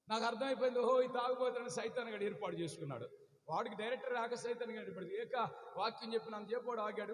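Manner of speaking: normal talking voice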